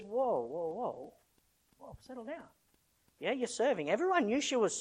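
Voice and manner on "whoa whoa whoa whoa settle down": calming whoa. Retold or imitated. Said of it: "Jesus just says".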